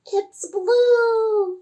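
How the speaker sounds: high, squeaky puppet cry; high-pitched puppet exclamation; octopus puppet. Acted. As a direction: call out the answer as a little toy octopus. Puppet gives the answer.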